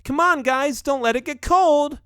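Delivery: high-pitched household call; wife's calling shout. A narrator's reading. Wife hurrying the family to table, calling out. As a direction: call them over in a high voice. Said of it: "Geron's wife shouts".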